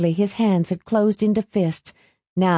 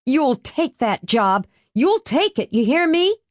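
fierce command; 7 semitones higher than usual. Acted. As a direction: lay down the law, pitch climbing. Mother forcing son into job.

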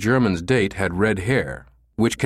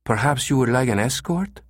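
higher female receptionist, gently questioning; careful, helpful woman; 4 semitones higher than usual. A narrator's reading. Receptionist aiding a potential client, careful female voice. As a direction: offer it carefully to a foreigner. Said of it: "spoke slowly in an effort to aid".

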